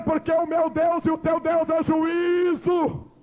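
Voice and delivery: slow, shouted altar call; fiery preaching. Real, not acted. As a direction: preach it, urge them to flee judgment.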